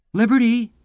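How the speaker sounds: high, loud call for the dog; eager loud call to a pet. A narrator's reading. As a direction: shout the name across the house. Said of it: "he cried".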